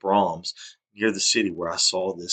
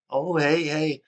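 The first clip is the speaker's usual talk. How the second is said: much higher, chirpy mock greeting; smiling hello; 9 semitones higher, much slower than usual. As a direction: ape the boss's too-cheerful hello. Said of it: "he comes in smiling like it's".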